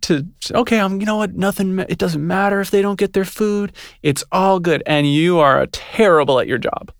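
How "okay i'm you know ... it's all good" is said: higher, easygoing put-on voice; mock carefree self-talk. Waiter imitating her own careless self, mock carefree. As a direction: play your too-relaxed self shrugging it off.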